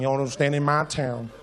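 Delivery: slow, low put-on cowboy; lazy Western gunslinger voice; cowboy drawl. Cowboy standoff quote in story.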